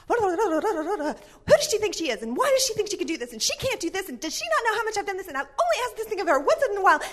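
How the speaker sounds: mock-indignant rant, ending in a laugh; ranting mom. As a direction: vent without stopping to breathe. Mom ranting to husband on walk.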